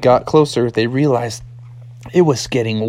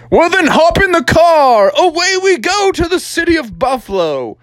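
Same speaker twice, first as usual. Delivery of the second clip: high, loud adventure announcement; hammed-up, high-pitched father rallying his son; rousing frog dad; 3 dB louder than usual. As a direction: sing out the trip like a gung-ho dad. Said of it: "And Froggy's dad said".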